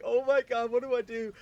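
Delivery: high, loud retold panic; frantic, high-pitched freak-out; panicked yell. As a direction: act out a scared kid yelling. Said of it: "I'm scared. I'm yelling".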